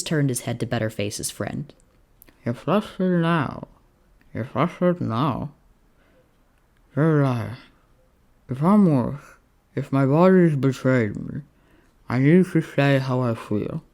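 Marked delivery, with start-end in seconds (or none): 2.4-13.8 slow, slurred sickbed voice; feverish Roman, insistent. Acted. Sick Roman to cowboy friend, slow and earnest. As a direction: press the point slowly, half-delirious.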